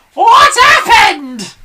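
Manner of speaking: very high, slow hag scream; Mrs Twit screaming. A narrator's reading. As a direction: scream it in alarm. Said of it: "screamed Missus Twit".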